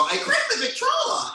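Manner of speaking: put-on high-pitched comic old-fashioned voice; mock old-timer. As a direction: squeal it like a dated oldster. Acts out an old-fashioned phrase.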